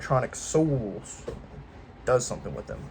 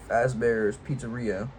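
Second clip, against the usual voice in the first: usual speaking voice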